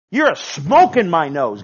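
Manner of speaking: God shouting his disgust at pride; loud voice of God. Acted. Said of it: "God says".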